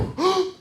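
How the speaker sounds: sharp high gasp of fake horror; high mock gasp; mock shocked gasp. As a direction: gasp like a scandalized acquaintance. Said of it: "They go".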